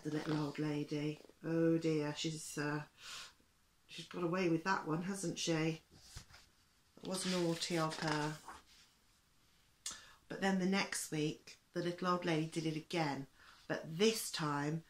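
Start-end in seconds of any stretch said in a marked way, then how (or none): none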